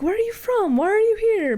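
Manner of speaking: high, curious quizzing voice; mimicking nosy locals. Podcast cohost mimics people quizzing her abroad, high nosy voice. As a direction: pitch it up like a nosy stranger. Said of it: "having people be like".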